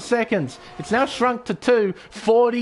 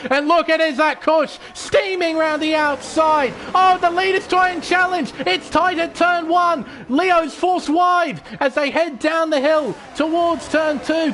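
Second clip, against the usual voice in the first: excited, higher race play-by-play; motorsport commentator; 6 semitones higher than usual. Acted. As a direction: call the race live, pushing the energy.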